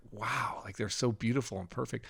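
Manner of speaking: quiet, awed wonder; hushed, tender wow; soft awe. Retold or imitated. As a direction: marvel softly at something perfect. Awed by the babies' beauty.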